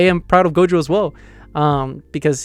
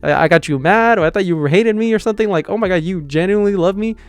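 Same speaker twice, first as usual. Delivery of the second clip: higher, giddy squeal of disbelief; excited disbelief; 4 semitones higher than usual. Retold or imitated. Marin, hearing her crush loves her, squeals in excited disbelief. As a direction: gush like she can't believe her luck. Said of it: "She's like".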